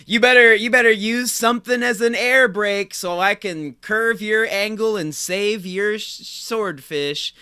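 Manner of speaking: grand game-show intro, slowing into the title; hyped segment announcer. Pretend. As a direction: announce it big, then stretch out the segment name.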